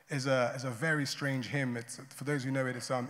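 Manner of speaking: normal talking voice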